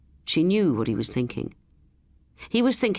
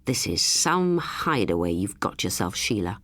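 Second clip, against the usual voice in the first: low, accusing dig; 7 semitones lower than usual. A narrator's reading. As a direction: pin the blame on her. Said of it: "looked up at her accusingly".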